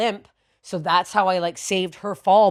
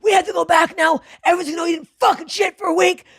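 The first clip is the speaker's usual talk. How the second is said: mad parents; 11 semitones higher, faster than usual. Retold or imitated. Parents scolding their kid after the hospital, mad. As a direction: imitate furious parents yelling in a rush. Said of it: "My parents were so mad".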